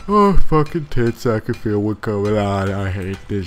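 talking right through a big yawn. Real, not acted. Gamer fighting sleep late at night, yawning as he talks. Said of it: "I haven't yawned in, like, the last minute".

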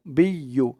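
normal talking voice

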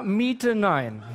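ordinary delivery

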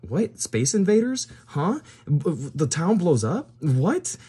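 high-pitched, bewildered questions; baffled, pitched well up; incredulous. Real, not acted. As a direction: react in rising disbelief to an absurd twist. Reacts to absurd storyboard twist.

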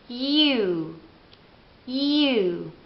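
U said as you, drawn out; English U. Shown as a demonstration. Teacher showing English U as you. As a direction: say U like the word you. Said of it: "si pronuncia così".

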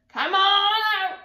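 high, loud, drawn-out bellow; bandit's bellow. Acted. Not Nice Nellie calling out the hiding town, loud. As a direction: roar it down the street.

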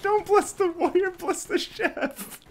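very high, slow pleading squeak; high squeaky voice. Acted. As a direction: squeal it, drawn out. Character pleads to deity.

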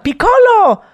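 high, drawn-out cartoon cry; Spain dub voice. Parodying the Spanish Dragon Ball dub, high dramatic cry. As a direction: call the name high, stretching the vowels. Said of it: "todo en español así".

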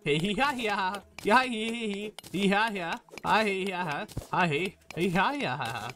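mock cackling. Cartoon hee-ha laughing.

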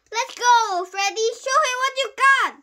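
high, loud screamed cheer; screaming. A narrator's reading. Girl cheering the boy before the fight, screaming. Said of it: "she turned and screamed".

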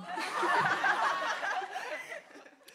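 a long laugh rising high; high-pitched laugh. Real, not acted. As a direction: laugh out loud, rising at the end. Laughing at own answer.

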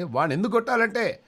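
very high, loud, laughing reveal; gleeful dentist. Acted. Dentist explaining why he wants the man punched, high and laughing. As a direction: crow it out with a cackle.

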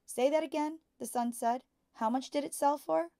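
eager son. A narrator's reading. Son asks father to repeat.

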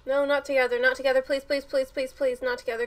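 loud, rapid-fire repeated pleas; urgent begging. Real, not acted. Viewer begs that they do not go together, urgent pleading. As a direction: plead over and over without stopping.

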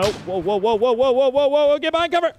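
mounting alarm. Real, not acted. Player rushing to get behind cover, mounting alarm. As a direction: build from startled to high shouting. Alarm under fire, building.

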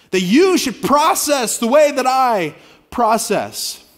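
loud, slow, insistent; mock demand. Pastor voicing a demanding partner, loud and slow. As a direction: push it hard and slow.

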